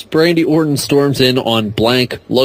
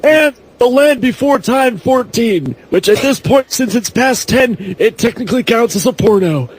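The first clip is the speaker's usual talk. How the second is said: higher, hammed-up hype pitch; mock promo voice; 7 semitones higher than usual. Friend pitching sequels as fake director, hammed-up promo voice. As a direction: sell it like a cheesy movie exec.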